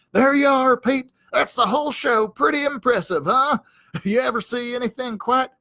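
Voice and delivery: high, proud show-off voice. Acted. Hank shows Pete his paw-waving flourish, high and proud.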